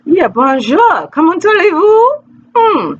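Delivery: high, showy French accent; mock French greeting. Shown as a demonstration. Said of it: "I had to take French".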